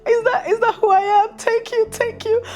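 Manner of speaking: high, squealing, overwhelmed thank-you; mock flattered gush. Replaying her swooning answer to the pastor, high, mock flattered. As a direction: gush like a swooning girl who can't believe it.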